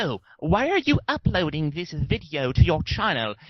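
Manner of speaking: mock British accent. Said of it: "not necessarily in the British accent".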